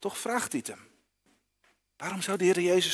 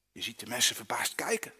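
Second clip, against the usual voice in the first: whispered, as if sharing a secret; storyteller's whisper; 4 semitones lower, 5 dB quieter than usual. A narrator's reading. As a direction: hush the voice to draw the children in.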